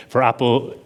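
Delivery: ordinary delivery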